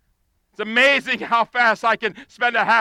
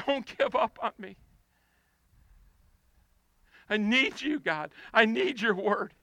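quiet plea; 6 dB quieter than usual. Acted. Quiet prayer after shouting.

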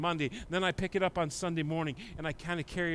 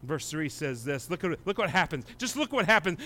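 ordinary talk, nothing marked

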